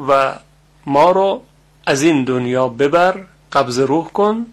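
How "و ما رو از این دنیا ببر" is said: ordinary talk, nothing marked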